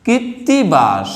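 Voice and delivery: কীর্তিবাস slowly, kit - ti - baash; careful model pronunciation. Shown as a demonstration. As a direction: draw out each syllable of কীর্তিবাস, doubled t. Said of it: "কীর্তিবাস শব্দটা হচ্ছে".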